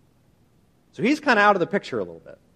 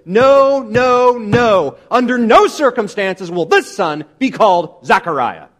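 loud, raised, hammering each no; emphatic refusal; 3 semitones higher, 7 dB louder, slower than usual. Retold or imitated. Preacher voices mother refusing the name, loudly emphatic. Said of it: "this is said emphatically. This is the most emphatic way".

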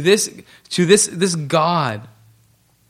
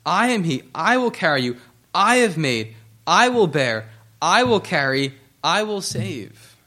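every "I" punched, the rest of each phrase falling away; measured list with "I" stressed each time, a pause after each phrase. Shown as a demonstration. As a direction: hit "I" afresh at the head of each short phrase. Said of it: "Notice the emphasis on "I".

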